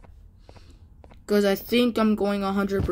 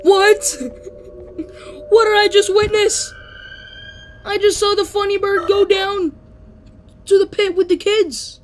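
much higher and louder, incredulous; shocked disbelief; 10 semitones higher, 6 dB louder than usual. Real, not acted. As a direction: blurt it out in stunned amazement. Shocked at bird falling.

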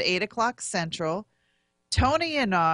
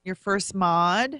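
slower, a little firmer; repeating clearly; slower than usual. Real, not acted. Repeating for a co-host who said "I'm sorry?", slow and clear. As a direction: say it over slowly for someone who missed it.

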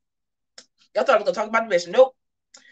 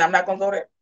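ordinary delivery

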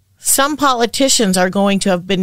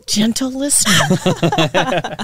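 mock formal address; slower than usual. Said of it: "I'm gonna wake up in the middle of the night".

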